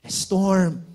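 loud, rising preacher's call; fiery sermon delivery; full-force preaching. Acted. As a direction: preach it out to the whole room. Preaching to the congregation.

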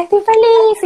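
bright joyful exclamation; truly happy. Shown as a demonstration. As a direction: beam and let the joy explode on "feliz". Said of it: "tú tienes que estar".

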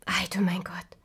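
whispered wonder; hushed amazement. Acted. Dwarves seeing the sleeping girl, whispered awe. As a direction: breathe it in awe. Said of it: "riefen sie".

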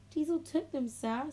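high, small-voiced admission; meek report. A narrator's reading. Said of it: "said Emily quietly".

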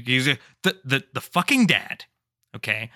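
ordinary talk

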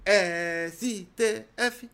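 very slow, drawn-out chant; slow recitation. Pretend. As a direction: spell it out slowly like a teacher. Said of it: "Repete comigo".

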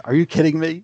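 incredulous but still in control; retold disbelief. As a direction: act out a first stunned reaction, fairly contained. Retold first reaction to lightsaber.

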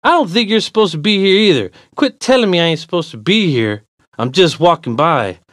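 loud, high, irritated comeback; annoyed. Acted. Walker tells stranger to quit it, annoyed. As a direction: throw his words back at him. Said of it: "my annoyance growing with each word now".

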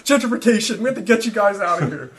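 mock furious shouting; angry shout. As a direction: rant at the homeless like a madman. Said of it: "felt like jumping on the sleeping bag".

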